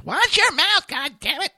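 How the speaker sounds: high, loud mock mom scolding; mom yelling. Host imitating his mom scolding him. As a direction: shriek it like an angry mom. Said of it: "my mom's always yelling at me".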